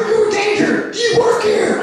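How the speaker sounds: high-pitched frantic questioning; urgent, strained high yell; mock urgent shout. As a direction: imitate a sheriff yelling at a hostage. Acts out sheriff questioning at gunpoint.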